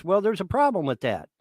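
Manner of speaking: ordinary delivery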